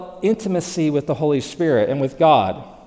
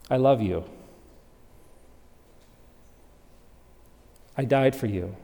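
soft, lower, unhurried promptings; tender inner voice; 4 semitones lower, 6 dB quieter than usual. Acted. The Holy Spirit prompting a believer, soft and tender. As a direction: speak like a loving whisper in the heart.

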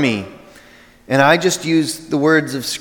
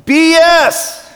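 sudden much higher, louder outburst; yelled; 13 semitones higher, 6 dB louder than usual. Retold or imitated. Atheist professor yelling at the play, loud outburst. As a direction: blurt it out in a shocked yell. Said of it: "he yelled".

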